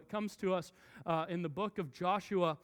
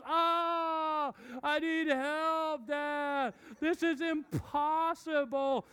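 put-on high, slow whining child; whiny kid; 7 semitones higher, much slower than usual. Son complaining to dad the puzzle is impossible. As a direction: drag it out like a defeated little boy.

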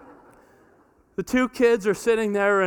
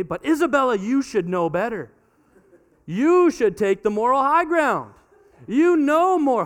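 higher and louder, insistent; firm parent lecture; 5 semitones higher, 4 dB louder than usual. Retold or imitated. Parent telling Isabella to take high ground, raised voice. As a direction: tell her she should know better.

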